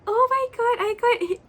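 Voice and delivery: high, loud excited squeal; overjoyed. Real, not acted. Fan who got the wished-for card, overjoyed squeal. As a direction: burst out with real delight.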